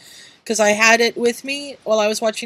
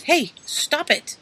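higher, slow firm telling-off; scolding; 6 semitones higher, slower than usual. Real, not acted. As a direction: scold the cat. Scolding the cat.

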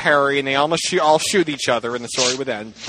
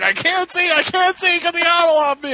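high-pitched frantic retold squeal; mock panic; 12 semitones higher than usual. Hermione retold, owl on her while driving, mock panic.

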